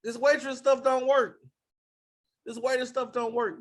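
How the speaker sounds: high, whiny mock-sobbing complaint; mock crying whine. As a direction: pretend to whimper like a hurt waiter. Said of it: "they don't start crying like".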